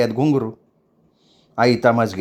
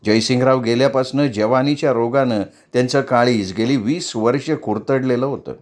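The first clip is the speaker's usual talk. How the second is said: ordinary delivery, nothing marked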